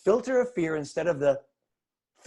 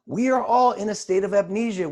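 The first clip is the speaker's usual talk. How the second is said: ordinary talk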